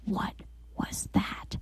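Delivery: hushed, frightened whisper; whispered question in the dark. Retold or imitated. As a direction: breathe it out in fear. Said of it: "And Todd says".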